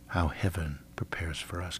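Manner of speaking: hushed and awed; whispering. Real, not acted. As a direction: whisper it in wonder. Awed closing thought.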